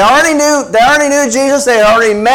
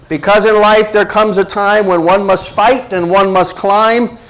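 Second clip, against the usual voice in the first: ordinary talk, nothing marked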